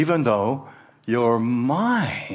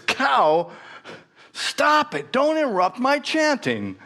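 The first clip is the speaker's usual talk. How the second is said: sharp, much higher angry rebuke; angry monk; 12 semitones higher than usual. Acted. Monk snapping at the cow, high and angry. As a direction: scold the cow for spoiling the chant. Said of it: "turns around angrily".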